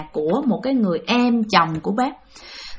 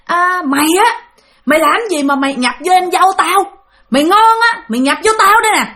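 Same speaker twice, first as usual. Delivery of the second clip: loud, higher furious taunt; angry challenge; 6 semitones higher, 3 dB louder than usual. Retold or imitated. Angry uncle Bảy challenging the ghost, shouting. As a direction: dare the spirit to come into you, shouting. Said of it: "tức quá mới đứng ra mới nói".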